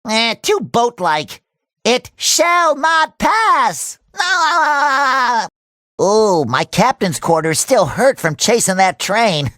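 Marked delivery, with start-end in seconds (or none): 1.8-4.0 slow, grand proclamation; higher, stretched heroic declaration; mock-epic defiance. 4.2-5.5 drawn-out wobbling yell; long wavering cartoon cry. Cry after the heroic stand.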